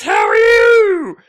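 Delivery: very high, slow, loud mock holler; shouted greeting, imitated. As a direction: stretch it into a screeching call. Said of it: "I hear this voice say".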